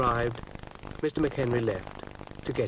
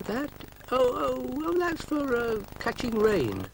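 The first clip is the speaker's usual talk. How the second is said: very high, flustered, halting; 14 semitones higher than usual. Acted. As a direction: stammer it out, caught out. McHenry flustered excuse.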